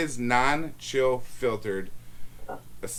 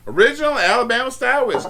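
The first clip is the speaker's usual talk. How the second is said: Southern slang; 10 semitones higher, 7 dB louder than usual. Real, not acted. Reading the whiskey brand in a Southern accent. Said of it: "I don't know why I said that in a Southern slang".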